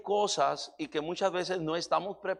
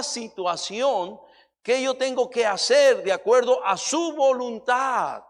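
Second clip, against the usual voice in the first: louder, impassioned preacher's voice, driving each phrase home; preaching at full force; 5 semitones higher, 7 dB louder than usual. Acted. Pastor urges listeners to know God's will, preaching loudly. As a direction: preach it hard to the congregation.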